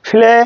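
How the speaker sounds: ফ্লে opening on a puff; aspirated P. Shown as a demonstration. Teacher drilling P words, aspirated ফ্লে. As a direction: play said with P like ফ. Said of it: "ফর মতো উচ্চারণ হলো".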